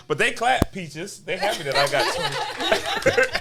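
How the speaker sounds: playful teasing with a laugh; just messing. Real, not acted. Host ribbing his producer about the episode count, playfully messing. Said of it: "I'm just messing".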